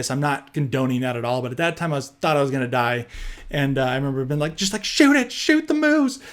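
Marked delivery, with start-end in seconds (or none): 4.6-6.2 higher-pitched, half-yelled plea; begging the friends to act now; mock yell. Yelling to armed buddies in the other tent, mock frantic yell. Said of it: "I was yelling at them".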